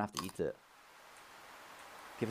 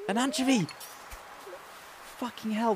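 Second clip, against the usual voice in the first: excited cry; 11 semitones higher than usual. Real, not acted. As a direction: cry out with glee at the catch. Excited at catching fish.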